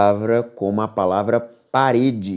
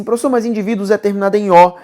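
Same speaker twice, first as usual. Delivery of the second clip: a hint of a protesting student, higher and fast; lightly voiced student objecting to the teacher; student objection; 7 semitones higher, 5 dB louder, faster than usual. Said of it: "Você vai dizer pra mim assim".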